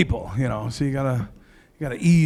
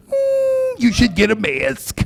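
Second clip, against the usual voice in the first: high, put-on voice of a health official; mock the flip-flopping expert; 9 semitones higher, much slower than usual. Comic mimicking a health official to the crowd, in a high put-on voice.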